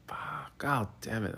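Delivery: hushed breathy swearing; cursing under breath. Real, not acted. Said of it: "Oh, I cursed".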